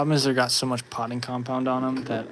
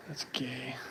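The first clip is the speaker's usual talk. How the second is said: whispered aside; 8 dB quieter than usual. Real, not acted.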